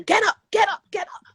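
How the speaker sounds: high, loud urging to get up; rally a friend back onto her feet; pushy pep talk. Retold or imitated.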